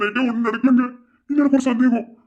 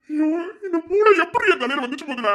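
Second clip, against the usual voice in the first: mock whine; 7 semitones higher than usual. Boyfriend panics at pregnancy news.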